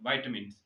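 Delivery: "विटामिन्स" repeated as VAI-tuh-mins, falling; English-style "विटामिन्स", vai-tuh-mins; correct pronunciation. Shown as a demonstration. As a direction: model "विटामिन्स" with opening "vai". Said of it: "सही pronunciation है वो".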